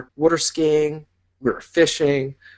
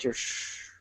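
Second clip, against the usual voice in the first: breeze hush; 14 dB quieter than usual. Shown as a demonstration. Imitating palm breeze softly.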